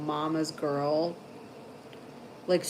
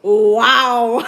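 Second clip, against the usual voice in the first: loud, stunned mock wow; hammed-up hurt exclamation; mock offended wow; 5 semitones higher, 13 dB louder than usual. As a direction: blurt it like a wounded dad. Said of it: "And Brian goes".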